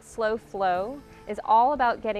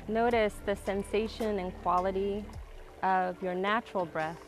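calm grounding exercise. Acted. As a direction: guide the breath, calm and unhurried. Guides a slow breathing practice.

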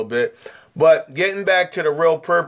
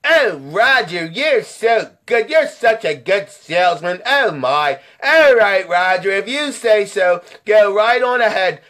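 flattered customer. Acted. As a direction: give in coyly, hamming up the admiration. Acts flattered customer Miss Johnson.

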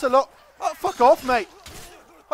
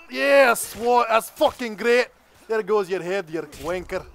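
hammed-up Scottish accent; a rowdy Scot swearing at the game in a Scottish accent; comic Scottish accent, lightly voiced; 6 dB louder than usual. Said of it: "Let's try to be a bit of Scottish".

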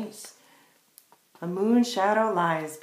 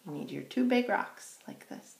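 usual speaking voice

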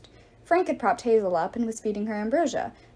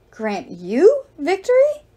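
loud, slow outraged goddess; slow, scornful divine outcry; indignant cry; 7 semitones higher, 6 dB louder, slower than usual. Acted. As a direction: hurl it back in disbelief. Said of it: "the goddess cried".

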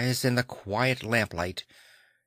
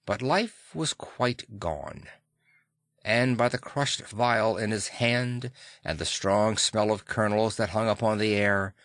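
ordinary delivery, nothing marked

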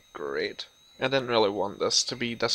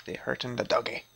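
ordinary talk, nothing marked

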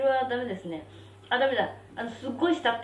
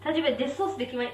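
ordinary talk, nothing marked

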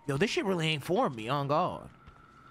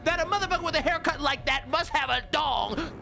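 hyped shouting; 12 semitones higher than usual. Real, not acted. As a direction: holler it at your chat. Hyping a character's haircut.

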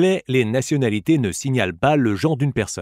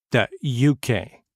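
slow repeat; 2 semitones lower, slower than usual. Shown as a demonstration. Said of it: "Écoutez et répétez après moi".

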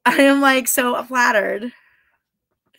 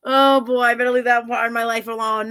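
usual speaking voice

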